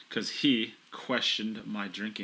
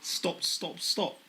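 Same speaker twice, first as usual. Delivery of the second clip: a higher put-on British accent on each stop; British accent again; 7 semitones higher, much slower than usual. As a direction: try the British stop once more, pitched up. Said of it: "Yeah, that was pretty good, actually".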